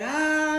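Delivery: short high mock yell of fright; imitating a scared kid's cry; retold scream. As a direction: yelp it like the boy. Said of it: "empezó a gritar".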